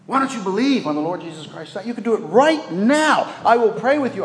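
retold earnest urging, high and loud; urgent pleading. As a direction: press him hard to trust Christ now. Retold pressing a man to decide.

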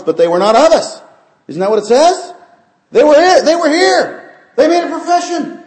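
loud, high-pitched pulpit shouting; full-force preaching. Real, not acted. Preacher driving a verse home to the church, full-force preaching. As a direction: preach it at the top of your voice.

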